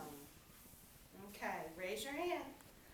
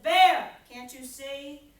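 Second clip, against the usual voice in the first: wind's voice; 12 dB louder than usual. A narrator's reading. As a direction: puff up as the proud wind. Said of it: "And the wind said".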